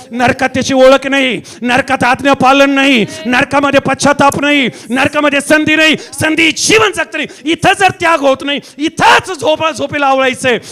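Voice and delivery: loud, driving sermon, line after punched line; full-force preaching. Real, not acted. (जोरदार, आवेशपूर्ण प्रवचन)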